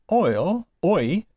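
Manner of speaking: "oil, oi" drawn out slowly; "oi" with the vowel of boil, slow; omicron iota sound. Shown as a demonstration. Teacher showing Greek omicron iota, slow sound demonstration. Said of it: "is pronounced as".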